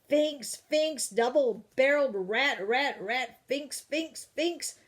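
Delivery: high, loud furious ranting; father storming home in a rage; angry ranting. Acted. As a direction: spit out every fink like a curse. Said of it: "He sounded very angry".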